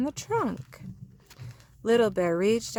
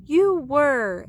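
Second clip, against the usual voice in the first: proud praise; 7 semitones higher, 7 dB louder than usual. Acted. Daddy Bear praises.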